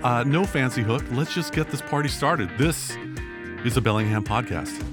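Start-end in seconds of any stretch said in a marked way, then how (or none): none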